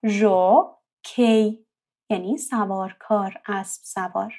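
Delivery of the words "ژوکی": ژوکی stretched very slowly; slow syllable demo. Shown as a demonstration. (ژوکی آهسته و کشیده)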